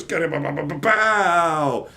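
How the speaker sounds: slow punching noises; cartoon punches; punch sound effects. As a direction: beat out each hit. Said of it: "he goes".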